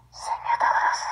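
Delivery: breathless sobbing whisper; crying whisper. Real, not acted. Grieving mother whispers a tearful plea to God. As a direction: plead through tears, barely voiced.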